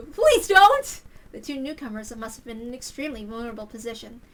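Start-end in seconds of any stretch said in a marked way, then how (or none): 0.1-1.0 high, loud panicked begging; desperate plea. Acted. Newcomers begging him not to call the police, high panic. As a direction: beg in panic.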